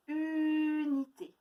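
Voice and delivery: unité slowly, lingering on the u; drawn-out word. Shown as a demonstration. Teacher to pupils, slowly stretching the word. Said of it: "d'autres mots où on entend U".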